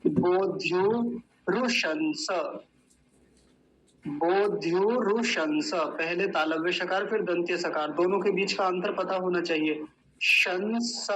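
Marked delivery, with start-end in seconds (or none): 0.1-5.7 बोध्यु रुशंस slow, श palatal then स dental; careful Sanskrit. Shown as a demonstration. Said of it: "ध्यान से समझेंगे इस वाले शब्द को".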